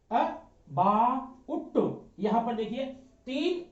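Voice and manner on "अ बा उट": ABOUT split as अ, बा, उट, each sound held apart; अ, then a long बा, then उट; broken into sounds. Shown as a demonstration. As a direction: say अ, बा, उट slowly, one piece at a time.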